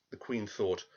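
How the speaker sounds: usual speaking voice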